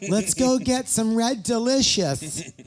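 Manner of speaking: high, slow, prissy Let's go get some Red Delicious; mock a rich suburban mom; mock rich lady.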